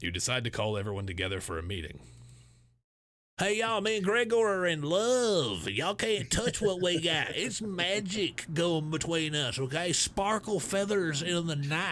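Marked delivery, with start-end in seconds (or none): 3.4-11.9 slow, drawn-out mock southern accent; southern Anatoly voice. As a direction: lay on a hammy southern drawl like Anatoly. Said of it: "gonna talk like this the whole time".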